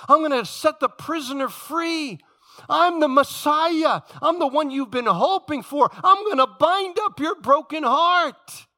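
high, loud preaching building in force; impassioned proclamation. Acted. Jesus announcing he is the Messiah, impassioned proclamation. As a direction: proclaim each promise at full voice, stretching the last.

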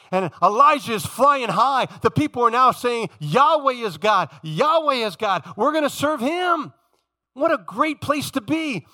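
high, ringing preacher's proclamation; exultant, emphatic pulpit delivery; triumphant preaching. Acted. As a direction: declare the victory to the whole church. Preaches at full force.

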